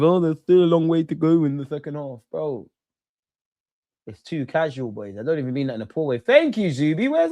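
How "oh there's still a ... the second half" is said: parroting a smug pundit in a put-on voice; mock pundit quote. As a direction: send up the complacent half-time line. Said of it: "no one come out with".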